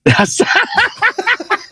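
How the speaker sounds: high, long, wheezy laugh; real burst of laughter. Real, not acted. Guest cracking up at the host's remark, high laughter. Laughs at having stolen his thunder.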